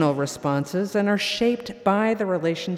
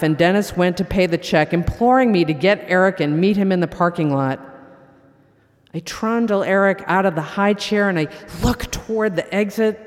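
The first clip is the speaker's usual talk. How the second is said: ordinary talk, nothing marked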